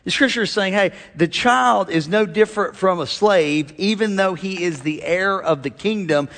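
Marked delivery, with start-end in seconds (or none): none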